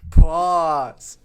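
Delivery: loud, drawn-out meme shout; mock callout. Host calls out the innuendo with a loud mock shout. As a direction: bark it like an alarm at a double meaning.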